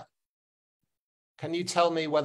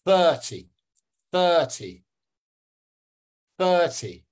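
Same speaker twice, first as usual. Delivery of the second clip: thirty with a loud THIR, dropping ty; THIR-ty, separate emphatic repeats; stress on first syllable; 2 semitones higher, 4 dB louder, slower than usual. Shown as a demonstration. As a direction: drill "thirty", punching the first syllable each time. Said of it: "The first syllable".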